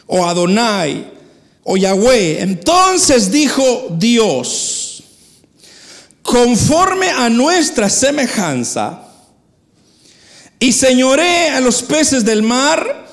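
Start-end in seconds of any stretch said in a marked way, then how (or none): 2.4-13.1 slow, solemn Bible reading from the pulpit; scripture reading. Acted. Preacher reads Genesis to the church, slow and solemn. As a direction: read the verse slowly with weight.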